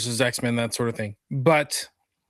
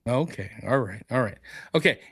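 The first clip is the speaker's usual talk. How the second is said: usual speaking voice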